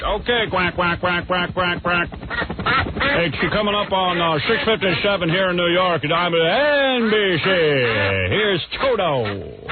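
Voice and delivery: hammed-up DJ with quacks and stretched call letters; high patter, drawn-out swooping song intro; Imus. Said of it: "I'll do an impression of Imus".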